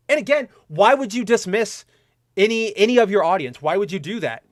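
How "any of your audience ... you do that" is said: ordinary talk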